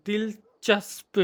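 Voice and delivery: दिलचस्प drawn out slowly, softly, sound by sound; slow, soft practice take of दिलचस्प; careful pronunciation. Real, not acted. Repeats the word to get it right.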